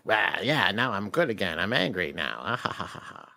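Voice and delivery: low, understated evil laugh, trailing off; mock the villain's turn to anger, low; mock evil resolve. Brainwashed villain declares anger, low mock cackle. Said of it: "she's like".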